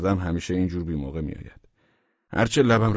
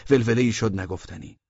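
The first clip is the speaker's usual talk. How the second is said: ordinary delivery, nothing marked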